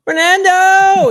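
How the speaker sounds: loud, high, drawn-out holler; window yell. Retold or imitated. [name] re-enacting his shout from the Uber, loud yell. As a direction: yell the name at full volume, high and long. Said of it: "yell out the window".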